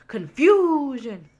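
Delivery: drawn-out mock yell, high and loud; imitated movie yell. Quoting a hilarious film scene to a friend, mock yelling. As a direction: bellow it slowly in comic imitation. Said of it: "he's just, like, yelling".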